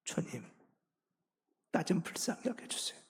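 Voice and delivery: soft, unvoiced plea for mercy; hushed, weeping whisper of prayer. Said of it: "통곡하며 우는 거예요".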